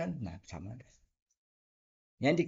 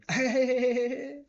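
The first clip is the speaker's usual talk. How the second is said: sudden high laugh, higher and louder; high burst of laughter; 12 semitones higher, 6 dB louder than usual. Real, not acted. Laughs at own jab.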